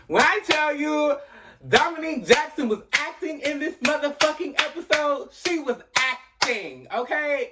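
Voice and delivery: high-pitched, excited raving; emphatic gushing. Real, not acted. Raving to viewers about Dominique Jackson's acting, emphatic gushing. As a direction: rave in a high voice, drawing out key words. Gushing over actress's performance.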